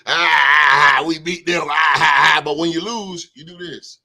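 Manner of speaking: loud mocking whoop that drops away at the end; hammed-up gloat, then fizzle out; mock gloating yell. Host imitating fans gloating in your face, loud mocking yell.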